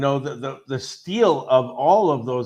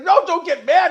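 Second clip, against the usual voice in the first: panicky plea; 13 semitones higher, 6 dB louder than usual. Retold or imitated. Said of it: "he's like".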